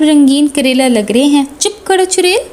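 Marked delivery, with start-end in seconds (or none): 1.6-2.4 annoyed snap. Acted. Brother snapping at teasing sister.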